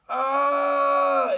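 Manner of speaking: high, loud yelp of alarm; pained cry. A narrator's reading. Tom sliding down the burning banister, yelping. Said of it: "he cried".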